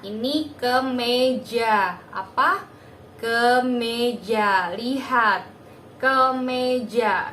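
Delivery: put-on a therapist naming a card to a toddler; clear teaching voice. Shown as a demonstration. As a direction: speak to a small child, slow and emphatic. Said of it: "perlahan satu-satu dulu".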